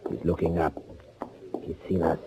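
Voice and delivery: hushed unvoiced warning; whisper. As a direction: breathe it so he can't hear. Whispered warning near a stranger.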